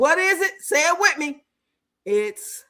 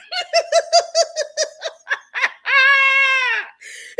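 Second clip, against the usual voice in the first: long cackle; 12 semitones higher than usual. Real, not acted. Host cracking up at own muddled saying, high cackle. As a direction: burst out laughing and let it climb.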